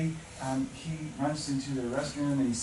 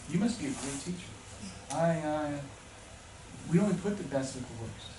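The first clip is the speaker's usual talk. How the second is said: ordinary delivery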